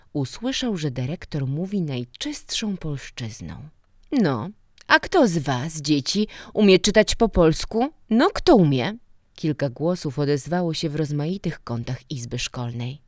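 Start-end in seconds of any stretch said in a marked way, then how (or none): 4.1-9.0 put-on louder, higher question to children; calling to a class. A narrator's reading. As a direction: rouse the whole class with the question. Director calls out to the class. (głośne pytanie do klasy)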